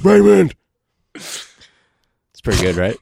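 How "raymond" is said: much higher, louder screech of Raymond; Everybody Loves Raymond mother. Retold or imitated. Sitcom impression for friends, high loud shrill call. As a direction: yell the name like a nagging mom. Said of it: "Michael Caine impersonation".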